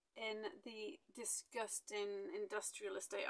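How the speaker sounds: ordinary delivery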